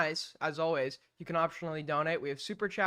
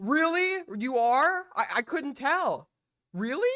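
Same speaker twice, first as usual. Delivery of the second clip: higher, louder mock surprise; sarcastic disbelief; 7 semitones higher, 6 dB louder than usual. Real, not acted. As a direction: act shocked to tease him.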